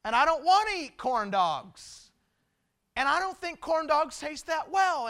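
hammed-up dad grump; grumpy complaint. Dad recalls grumping over zoo corn dog menu, mock complaint. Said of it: "I'm grumping".

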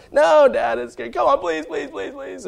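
put-on high, begging child voice; kids pleading. Kids pleading with their dad, high begging.

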